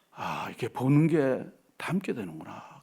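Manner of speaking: slow hushed whisper to himself; whispered realization. Retold or imitated. Preacher whispering his own shocked realization to the congregation. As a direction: breathe the thought out slowly, barely voiced.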